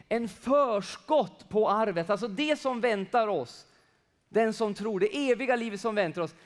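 loud fervent preaching. Real, not acted. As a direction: proclaim it with fire.

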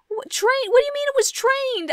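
very high, loud indignant protest. Real, not acted. Gamer protesting to the game about her dog, indignant. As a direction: defend yourself, outraged.

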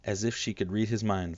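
ordinary delivery, nothing marked

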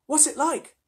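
put-on high-pitched curious girl; Lucy's little-girl voice. A narrator's reading. Lucy asks about the animal, high girlish voice. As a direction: ask it in a small high voice.

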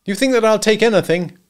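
loud, high, indignant challenge; indignant husband. A narrator's reading. Husband to wife in night quarrel, indignant.

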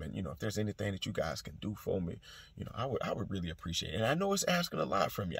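soft, low, smooth sweet-talking; smooth jazz voice. Retold or imitated. Veteran sweet-talks the clerk for paperwork, soft and smooth. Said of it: "hitting them with the smooth jazz".

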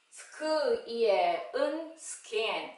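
"스크, 이에, 은" apart, then "스킨" drawn out; phonics blending. Shown as a demonstration. Teacher reading SK words, segmented then blended. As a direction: sound out each part before "스킨". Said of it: "에스케이 글자로".

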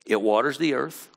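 ordinary talk, nothing marked

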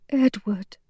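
soft, murmured name; murmuring. A narrator's reading. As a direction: murmur his name gently. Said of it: "murmured Bess".

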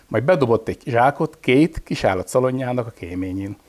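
plain reading voice

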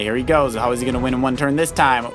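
ordinary talk, nothing marked

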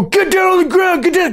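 high-pitched mock police command; frantic cops. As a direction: caricature a cop yelling orders. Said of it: "And then the cops go".

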